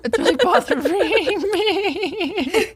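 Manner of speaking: high-pitched helpless laughter; laughing. Real, not acted. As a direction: laugh through the words. Grossed out, cracking up.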